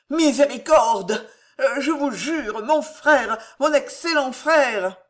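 high, loud, drawn-out pleading; alarmed pleading. A narrator's reading. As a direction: beg for mercy in a panic. Lubin begs after threat of convent.

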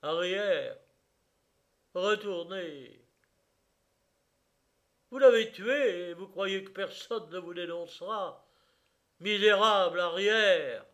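high, loud angry commands; shouted accusation. A narrator's reading. A man clinging to the coffin accuses the gendarmes, shouting angrily. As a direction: drive them back with furious cries.

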